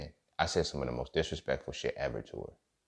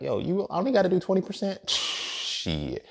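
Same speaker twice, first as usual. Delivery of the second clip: mock exploiting reboundee; 14 semitones higher, slower than usual.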